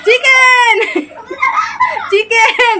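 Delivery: high sing-song call of chicken. Real, not acted. Owner calling her pet bird in a high sing-song.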